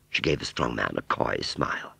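ordinary delivery, nothing marked

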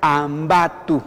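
slowly sounded-out Ambato, ending in a 'tu' sound; careful Quechua model of Ambato; pronunciation demo. Shown as a demonstration. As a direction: say Ambato syllable by syllable, like a teacher. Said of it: "Entonces se pronuncia".

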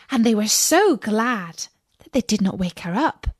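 ordinary delivery, nothing marked

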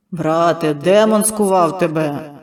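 loud, slow, growling preacher; growled command. Acted. Healer growling at Mykhailo, loud and slow. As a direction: growl it at the possessed boy. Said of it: "загарчав брат Еудор".

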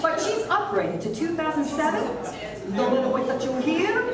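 ordinary delivery

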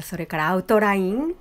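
ordinary delivery

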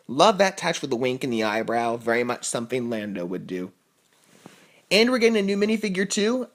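normal talking voice

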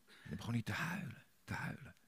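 moved, trailing into a whisper; fading to a whisper. Real, not acted. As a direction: voice dropping away to nothing. Emotional retelling, voice fading.

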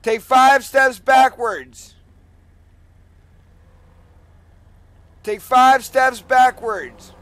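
booming police loudspeaker commands; bullhorn with siren. Real, not acted. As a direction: bark orders through a megaphone, slowly. Police stop commands over loudspeaker.

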